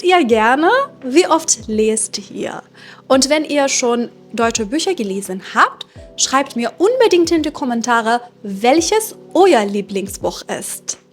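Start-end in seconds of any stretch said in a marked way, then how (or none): none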